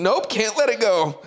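retold snapping. Caddy recalling the urge he couldn't hold back, acted outburst. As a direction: reenact restraint suddenly giving way. Reenacts snapping after trying restraint.

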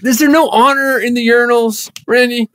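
mock outrage. Boss's mock-outraged plea.

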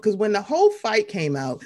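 ordinary talk, nothing marked

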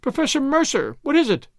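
high-pitched, horror-stricken plea; girl crying out in alarm; urgent questions pitched high in fright; frightened cry. A narrator's reading. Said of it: "she cried".

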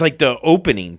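ordinary talk

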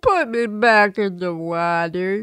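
slow, pleading little creature voice; sea hare voice. Pretend. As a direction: beg slowly to go back in.

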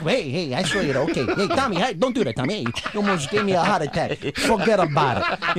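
jumpy Brooklyn wiseguy, laughing through it; a nervous wiseguy dodging trouble; hammed-up Brooklyn accent; Brooklyn tough guy. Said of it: "they don't trust somebody".

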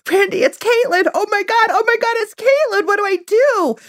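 very high-pitched, breathless-sounding reenacted panic; frantic excitement. Retelling her frantic reaction to husband.

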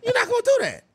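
high, laughing warning. Real, not acted.

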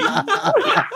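high helpless laugh; can't stop laughing; real laughing fit, high-pitched; real high laughter. Real, not acted. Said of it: "was laughing".

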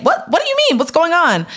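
a friend suddenly worried; alarmed friend. Retold or imitated. Friend on the phone hearing bad news, startled high.

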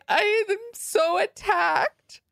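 high, drawn-out mock wail; mock crying. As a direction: imitate a tearful, self-pitying cry to camera, stretched and high. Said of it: "starts crying into the camera".

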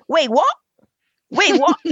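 mock American teen English; English accent Portuguese. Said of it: "como é que o cara fala?". (imitando sotaque americano)

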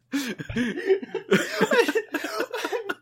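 high, long burst of laughing; helpless laughter. Real, not acted. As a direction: crack up completely at the joke. Cracking up at the bit.

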